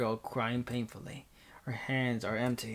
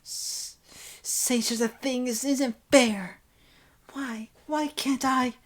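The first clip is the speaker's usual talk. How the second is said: put-on high, drawn-out childish protest; spoiled child shouting; 11 semitones higher, 4 dB louder, slower than usual. Senpai crying that it isn't fair, like a spoiled child. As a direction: wail it high, like a thwarted child. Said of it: "shouts out like a spoiled child".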